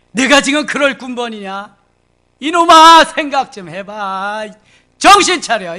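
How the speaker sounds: loud, high rebuke; loud exasperated scolding. Acted. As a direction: shake him awake with anger. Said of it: "예수님이 막 책망을 하고 있습니다".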